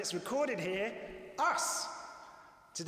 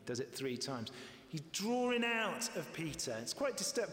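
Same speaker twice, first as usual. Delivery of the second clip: ordinary talk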